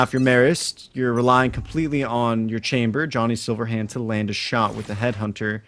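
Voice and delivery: ordinary talk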